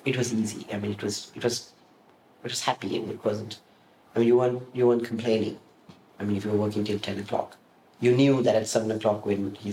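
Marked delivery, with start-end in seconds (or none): none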